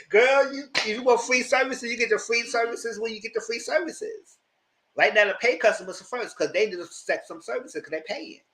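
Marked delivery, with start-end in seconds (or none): 0.1-4.4 higher sassy put-down; sassy lecturing girl voice; sassy scold. Retold or imitated. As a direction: talk down to her with attitude.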